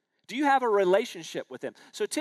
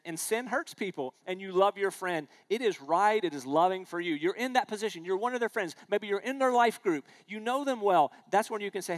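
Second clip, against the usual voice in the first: ordinary talk, nothing marked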